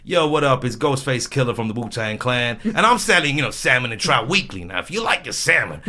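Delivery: hyped rapper phone pitch, lively swings. Acted. Bored telesales worker cold-calling as a rap star, hyped rapper voice. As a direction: sell it like a rapper character. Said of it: "We used to start doing accents".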